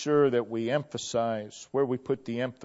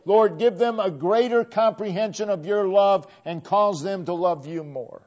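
louder, higher fiery preaching; preaching at full force; 5 semitones higher, 7 dB louder than usual. Acted. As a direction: lift the congregation with rising fervor. Preaching builds to a climax.

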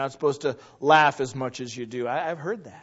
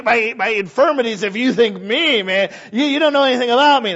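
loud, high, pleading protest; preacher acting out his past self arguing with God, loud; retold self-doubt; 7 semitones higher, 9 dB louder than usual. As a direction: cry out that you're not worth saving. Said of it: "I'd be like".

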